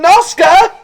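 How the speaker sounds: very high, loud call. Acted. Guide yelling for Noska at the door. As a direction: shout the name up at the rafters.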